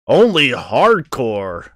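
slow, loud, drawn-out delivery; loud, theatrical slow drawl; hammed-up hardcore. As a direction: stretch it out with mock intensity. Hamming up a game line.

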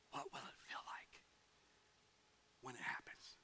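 soft voiceless whisper from the baptistry; whispered question. Retold or imitated. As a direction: whisper it nervously in front of the crowd.

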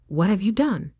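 usual speaking voice

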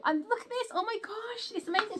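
high, bright burst of amazement; delighted surprise. Real, not acted. Showing viewers the chest, high thrilled amazement.

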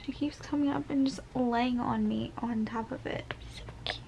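soft whisper so the cat keeps sleeping; quiet whisper near a sleeping cat; hushed whisper. Real, not acted. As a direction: whisper it, barely voiced. Cat lying on belly.

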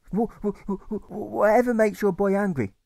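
high, stuttering protest; a frightened boy pleading against cruelty; horrified stammer. A narrator's reading. Alfred stammers in horror at the carer. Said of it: "Alfred suggests and protests".

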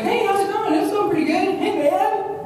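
high, lively hello; acted greeting. Arriving out west and greeting people, high and lively. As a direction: pitch up a friendly street greeting. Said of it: "so you get out, and like".

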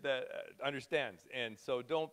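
normal talking voice

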